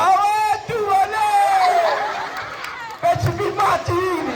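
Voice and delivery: long, high falling wail; panik saat jatuh. Acted. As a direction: cry out in comic panic, stretching the words high. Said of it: "Dia jatuh, dia bilang". (jeritan panik, melengking panjang)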